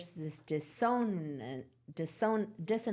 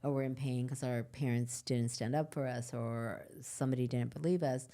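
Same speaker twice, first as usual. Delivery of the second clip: normal talking voice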